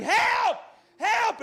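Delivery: high-pitched panicky cry; acted high yelp for help; yelling for help. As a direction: call out for rescue while fleeing. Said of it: "And I'm yelling".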